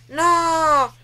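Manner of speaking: plain reading voice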